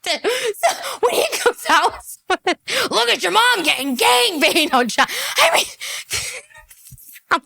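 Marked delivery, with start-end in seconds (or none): 0.0-5.1 high giggle through the words; cracking up mid-quote; real laughter spilling into the line; laughing. Real, not acted. Laughing while repeating quote.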